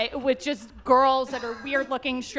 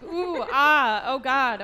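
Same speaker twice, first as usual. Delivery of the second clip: mock moaning; 4 dB louder, much slower than usual. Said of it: "I'm like".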